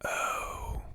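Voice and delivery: ordinary delivery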